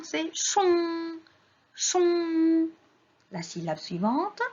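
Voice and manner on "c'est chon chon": "chon" high and long-held; modelling a syllable. Shown as a demonstration. Teacher reads syllables to children, sounding them out. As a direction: hold the nasal vowel of "chon".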